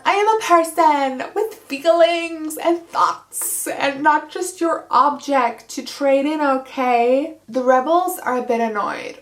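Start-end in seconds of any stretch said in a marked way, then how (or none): 0.1-7.4 high, slow, indignant mock protest; lightly voiced huffy girl; mocking America. Recapper mocks America's outrage, high huffy voice. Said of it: "America's also mad".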